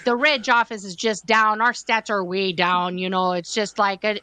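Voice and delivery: complaining in a hammed-up Midwest accent. [name] griping about the reg office in mock Midwest accent. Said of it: "we would talk to each other like this".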